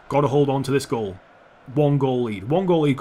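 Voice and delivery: ordinary talk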